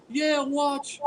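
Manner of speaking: lightly retold sister's warning; imitating tearful sister. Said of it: "she's crying on the phone".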